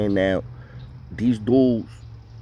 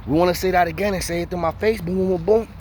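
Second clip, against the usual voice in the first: quick, raised imitation of a challenge; confrontational; 7 semitones higher than usual. Inmate getting in Dom's face, quick and raised. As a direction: fire it at him up close. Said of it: "he go over to Dom in his face".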